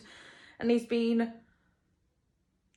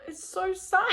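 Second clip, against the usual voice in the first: high, tearful squeak; choked up, voice climbing high; getting emotional; 9 semitones higher than usual. Real, not acted. Book fan overcome by a character's grief, tearful and high. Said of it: "I'm getting emotional even thinking about it".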